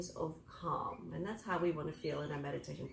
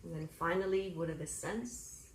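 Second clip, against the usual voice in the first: guided meditation; 6 dB quieter than usual. Acted.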